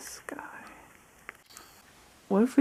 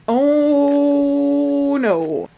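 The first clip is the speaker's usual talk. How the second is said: mock dismay; 6 semitones higher, 7 dB louder than usual. Real, not acted. Catches him at her cereal.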